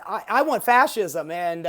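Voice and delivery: usual speaking voice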